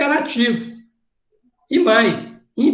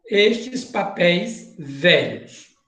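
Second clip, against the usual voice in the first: said slowly, word by word; slow and deliberate; 4 semitones lower, much slower than usual. Shown as a demonstration. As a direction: spell it out slowly for the student. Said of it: "preste atenção". (devagar e pausado)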